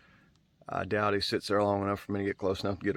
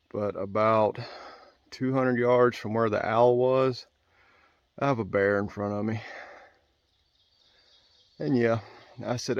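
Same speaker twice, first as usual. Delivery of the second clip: normal talking voice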